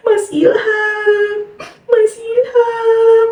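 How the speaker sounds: high, very slow wailing sobs; possessed crying. Retold or imitated. Possessed wife weeping for Ilham, high slow sobbing. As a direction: wail his name through tears, drawn out. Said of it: "Nangis dia".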